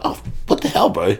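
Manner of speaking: high, breathy mock disbelief; mock outrage. Bandmates learning he moved away, high mock outrage. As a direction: throw up your hands, half-joking. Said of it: "And we're like".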